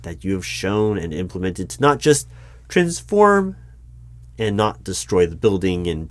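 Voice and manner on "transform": TRANSFORM pushed high and loud; emphatic high jump. Acted. Interviewer thanks dragon for restraint, "transform" high and loud. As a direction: leap up in pitch on "transform" and hold it.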